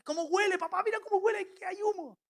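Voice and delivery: put-on alarmed boy calling out to dad; scared kid. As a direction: son telling dad it smells of smoke. Son alarmed by the smoke.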